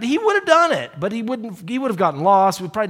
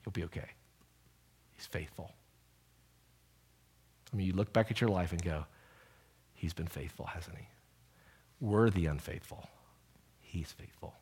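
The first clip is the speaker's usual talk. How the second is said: much softer, lower, almost breathed; hushed sermon; 7 semitones lower, 12 dB quieter than usual. Real, not acted. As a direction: lean in and murmur it to the room. Hushed preaching of faithfulness.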